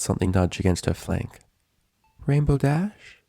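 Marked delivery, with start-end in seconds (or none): 2.3-3.2 lightly voiced, higher gentle nurse; a hint of a kind nurse; calm feminine voice. A narrator's reading. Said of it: "It was a calm, feminine voice".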